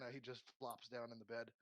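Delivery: ordinary delivery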